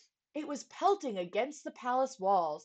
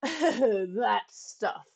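laughed; 7 dB louder than usual. A narrator's reading. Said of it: "laughed the captain".

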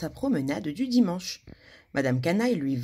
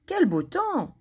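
gleeful thought; 10 semitones higher, slower than usual. Acted. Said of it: "pensa-t-elle".

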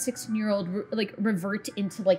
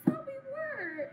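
very high, softer mock crying; Jackson; 8 dB quieter than usual. Game master as Jackson hurt about the relationship, high tearful whine. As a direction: squeak it out as a hurt, weepy boy. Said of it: "he's crying".

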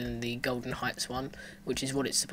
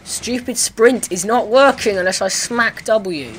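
loud, high, drawn-out exasperation; high-pitched, slow, fed-up rant; real frustrated outburst; 8 semitones higher, 12 dB louder, slower than usual. Real, not acted. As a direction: complain loudly at a broken control. Frustrated gamer cursing the controls.

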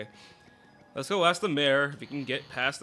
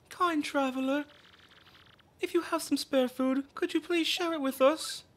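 pleading mother; 10 semitones higher than usual. Acted. Mother asks for food.

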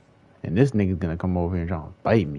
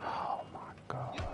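softer, slow exasperated whisper; real weary whisper; 17 dB quieter than usual. Real, not acted. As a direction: breathe it out in disbelief. Exasperated after long pause.

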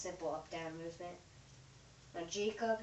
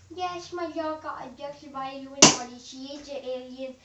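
mock shout; 5 semitones higher, 11 dB louder than usual.

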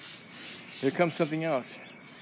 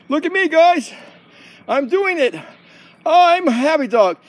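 high, pleased show-off dog; happy dog voice; 11 semitones higher, 7 dB louder, slower than usual. Pretend. [name] shows off to the camera, high happy voice.

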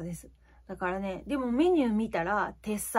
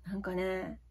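usual speaking voice

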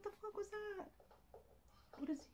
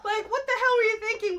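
higher, laughing through the words; disbelieving laughing rant; 4 semitones higher than usual. Real, not acted. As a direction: keep ranting while cracking up. Continues laughing outburst.

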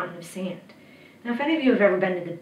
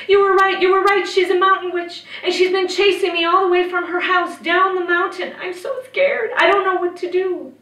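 high, loud breathless-with-panic report; acted scared child, pitched way up; frightened boy; 9 semitones higher, 6 dB louder than usual. A narrator's reading. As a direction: blurt it all out in a panic. Frightened boy begs monk for help.

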